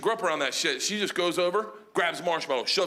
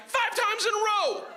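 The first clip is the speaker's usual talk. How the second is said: high-pitched shouted outrage; mock-appalled yell; disgusted outburst. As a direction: cry it out in appalled disbelief. Comic outrage at bear kissing him.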